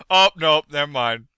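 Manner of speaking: usual speaking voice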